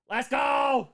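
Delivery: rising, shouted let's go; loud cheer. Shown as a demonstration. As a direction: belt out go, high and loud.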